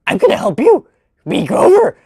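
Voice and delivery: squeaky go at Grover; lightly voiced Muppet attempt; Grover impression. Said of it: "The next one we have is gonna be Grover".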